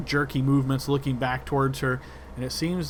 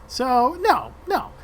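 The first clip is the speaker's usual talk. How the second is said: higher, louder grinning refusal; grinning rat leader; 4 semitones higher, 5 dB louder than usual. Acted. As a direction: refuse with a smug grin. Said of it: "you see she kinda grins and says".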